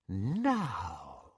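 low and soft, almost purring; soft, menacing. A narrator's reading. Bellatrix to Narcissa, soft low menace. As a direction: say it softly, savouring control. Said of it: "said Bellatrix softly".

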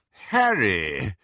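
out of breath from running; panting. Acted. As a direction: gasp the name, panting. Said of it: "he panted".

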